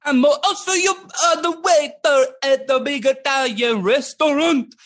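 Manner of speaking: high, drawn-out Italian waiter; Italian accent. Acted. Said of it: "you can do an accent if you want to".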